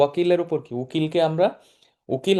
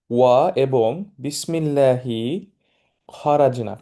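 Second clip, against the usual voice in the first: very slow, each syllable laid out; slow teaching demo; slower than usual. Shown as a demonstration. Teacher to students, showing the dua word by word, very slow. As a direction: spell it out slowly for the class. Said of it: "teacher spelling out the dua".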